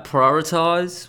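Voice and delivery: prioritize stretched out slowly and loudly; drawn-out jargon word. Shown as a demonstration. Vlogger mocking jargon, drawn-out prioritize. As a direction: savour prioritize like a buzzword. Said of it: "Tell me what I just said in English".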